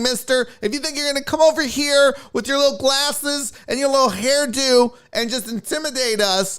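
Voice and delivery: high-pitched mock Karen voice; exaggerated high indignant Karen. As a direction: play a bossy, self-appointed peacemaker, pitched well up. Voicing the Karen in retelling.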